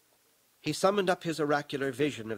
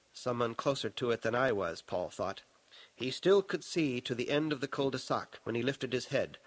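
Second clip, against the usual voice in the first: plain reading voice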